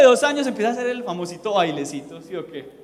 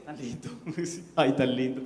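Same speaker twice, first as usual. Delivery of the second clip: cooing; 7 semitones lower, 6 dB quieter than usual. Retold or imitated. Cooing at a new believer.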